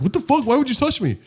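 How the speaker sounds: high-pitched startled protest; mock indignation. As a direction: squeal it like someone offended by a touch. Imitates startled people being touched.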